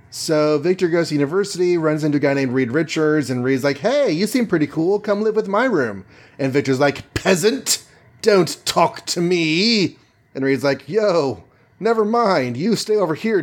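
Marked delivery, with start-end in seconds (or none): 3.8-6.0 lightly acted friendly invite, pitched up; higher, chummy greeting to a new roommate; friendly Reed. Voicing friendly Reed inviting Victor. 7.1-10.0 mock-imperious snub, pitched up and drawn out; higher, slow, lofty put-down; haughty Victor. Voicing Victor snubbing Reed. 10.9-13.4 lightly acted backing off, pitched up; higher, casual brush-off; rebuffed Reed. Voicing Reed backing off from Victor.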